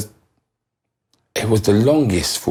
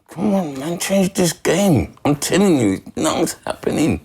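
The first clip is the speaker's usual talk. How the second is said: pleading inner thought; 9 semitones higher than usual. Retold or imitated. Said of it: "I'm thinking".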